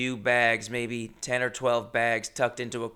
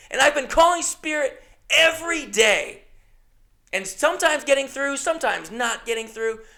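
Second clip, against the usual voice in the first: higher-pitched exasperated rant; angry swearing; 9 semitones higher than usual. Retold or imitated. To the airline worker about eight days lost: exasperated angry rant. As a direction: pile on the grievances, swearing. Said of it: "but I am fucking, like, swearing".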